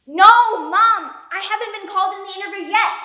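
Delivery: loud, fast, exasperated; annoyed at Mom. Acted. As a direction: snap back at a nagging mother. Arguing with mom on phone.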